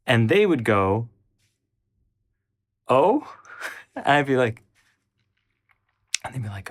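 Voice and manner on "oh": surprised oh. Retold or imitated. Friend reacts to vampire news.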